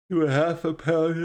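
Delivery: said through a real yawn. Real, not acted. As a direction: let a yawn stretch the words out. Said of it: "Excuse me for yawning".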